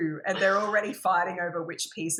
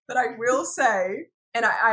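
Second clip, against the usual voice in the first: normal talking voice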